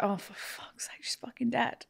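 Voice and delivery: hushed whispered curse; whispering. Retold or imitated. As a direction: whisper it under your breath. Retold reaction at film ending.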